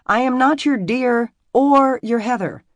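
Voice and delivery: loud, high furious protest; frustrated outburst. A narrator's reading. Emmy rejecting the name Heather, loud frustrated outburst. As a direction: throw it back at him, fed up. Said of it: "threw her hands over her head in frustration".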